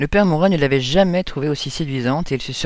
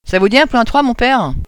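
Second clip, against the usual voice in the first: high and loud, a cheeky brazen offer; the intruding girl teasing the priest, high and brazen; brazen provocation; 9 semitones higher, 6 dB louder than usual. Acted. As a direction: shock the priest with a bold proposition. Claire bursts in provoking priest.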